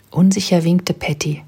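ordinary delivery, nothing marked

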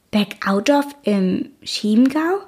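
slow, surprised rising question; stunned, checking he heard right; a touch of amazed disbelief; astonished question. A narrator's reading. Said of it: "fragt er erstaunt".

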